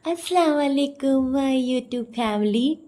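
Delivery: high, slow, put-on loving greeting; sugary imitation of a vlogger's hello; mock sweetness. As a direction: draw it out with exaggerated affection. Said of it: "इतने प्यार से बोलती".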